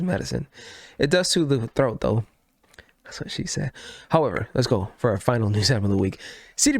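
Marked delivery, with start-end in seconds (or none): 3.0-3.7 whispered joke aside; whispering. Acted. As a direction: whisper it under your breath. Joke muttered under breath.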